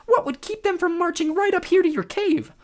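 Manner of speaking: very high squeaky stammering; nervous falsetto. A narrator's reading. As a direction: stammer in a fake bride voice. Grover as bride.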